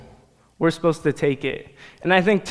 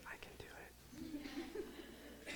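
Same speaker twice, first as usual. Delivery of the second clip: whispered; 13 semitones higher, 31 dB quieter, much faster than usual. Pretend. Jokes about eating six saltines.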